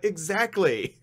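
high, loud, knowing "exactly"; a smug teammate agreeing, pitched high and loud; smug agreement. Retold or imitated. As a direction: play it like the clever one who is always right. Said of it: "So he's just like".

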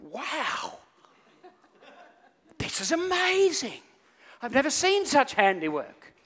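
high, drawn-out mock amazement; awestruck king. King admires a suit he cannot see, hammed-up awe. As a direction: slowly overact being wowed. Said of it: "the king stands there and goes".

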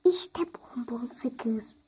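slow, hushed whisper. Acted. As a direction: whisper slowly over the found gum. Sneaking gum, whispering. (yavaş fısıltı)

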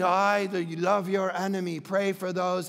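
low, even, lifeless movie-Jesus delivery; monotone Jesus. Acted. Preacher mocking Mister Monotone movie Jesus, monotone. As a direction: drone it mildly, with no emphasis at all. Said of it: "he walks around monotone".

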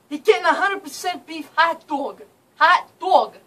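hammed-up New York outrage; acted telling-off. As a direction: hammer it home to the clerk. Acted telling-off of the shop clerk.

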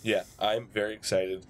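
normal talking voice

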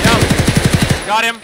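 loud, high triumphant callout; excited shout. Real, not acted. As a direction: yell it as the kill lands. Kill callout.

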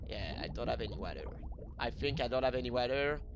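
rapid mutter under breath; fast low muttering. Real, not acted. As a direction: mumble it quickly to yourself. Running out of air.

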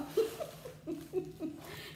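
airy high-pitched giggle; breathy high laugh. Real, not acted. Laughing at her crazy bunny, airy high giggle.

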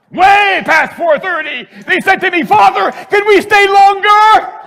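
loud, high, soaring testimony; full-force preaching. Real, not acted. Priest to congregation, shouting in ecstatic praise. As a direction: preach it to the rafters.

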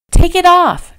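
high, loud urgent command; ordering. A narrator's reading. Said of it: "she ordered".

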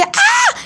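high, short scared scream; girl's scream. Retold or imitated. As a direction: shriek in fright. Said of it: "Susie grita y dice".

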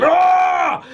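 high, loud yell of attack; battle cry. Acted. Kuma punches Saturn, high loud battle yell. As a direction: roar as the punch lands.

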